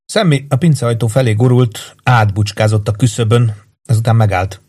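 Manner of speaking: ordinary delivery, nothing marked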